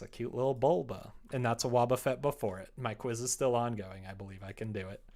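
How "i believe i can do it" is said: ordinary talk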